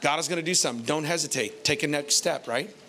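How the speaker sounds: ordinary delivery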